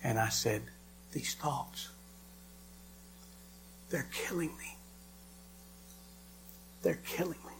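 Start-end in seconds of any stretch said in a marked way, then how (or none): 1.1-7.5 broken plea. Retold or imitated. Answering Jesus in despair.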